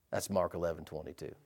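low, soft, almost murmured reference; quiet reading. Shown as a demonstration. Preacher quietly cites the verse to the church, hushed. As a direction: drop it to a hush. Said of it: "I said".

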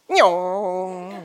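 long drawn-out engine whine, starting high; imitated engine noise. Preacher mimics the car slowing him behind another, imitated engine drone. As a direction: make the drone of a car held back.

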